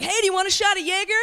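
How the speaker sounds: lightly voiced partygoer, fast and high; eager partygoer. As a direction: pitch it up and rattle off the offer. Acts out partygoer offering a drink.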